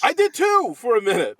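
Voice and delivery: very high, loud mock squeal; high-pitched voice. Co-host agreeing about the sketch's odd voice, in high-pitched mimicry. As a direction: pipe up in a loud falsetto. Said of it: "Really high-pitched voice".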